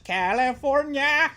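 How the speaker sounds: slow, high sing-song mock-Irish; drawn-out Irish-accented singing. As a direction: attempt a rap hook in a hammed-up Irish accent. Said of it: "do [name] in an Irish accent?".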